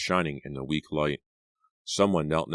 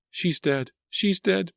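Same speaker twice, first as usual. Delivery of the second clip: much higher, frantic shrieks; high panicked shriek repeated; 3 dB louder, slower than usual. Acted. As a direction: shriek it in panic over her cousin. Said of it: "Pam shrieked".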